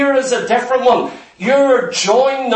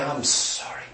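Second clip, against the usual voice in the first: much softer and lower, a broken weeping plea; tearful apology; 9 semitones lower, 11 dB quieter than usual. Acted. A broken person begging forgiveness, softly weeping. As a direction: whisper it as if breaking down in tears. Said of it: "break down and weep and say".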